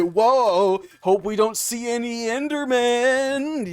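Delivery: high, slow, wide-eyed gasp; hammed-up YouTuber wonder, drawn out; Minecraft YouTuber voice. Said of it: "fucking Minecraft YouTuber voice".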